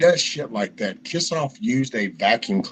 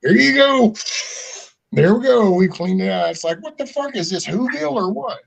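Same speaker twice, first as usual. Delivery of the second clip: mock triumph; 9 semitones higher than usual. Mocking someone who cleaned a fish tank with a vacuum, high and gloating. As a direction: crow it like a proud fool.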